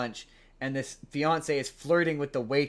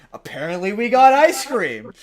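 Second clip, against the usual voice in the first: sarcastic jab; 6 semitones higher, 11 dB louder than usual. Retold or imitated. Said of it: "he keeps going".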